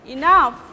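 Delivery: "enough" with unstressed E and strong NOUGH; primary stress. Shown as a demonstration. Teacher showing unstressed then primary, NOUGH stressed in "enough". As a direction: punch the second syllable of "enough". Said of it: "this will be primary stress".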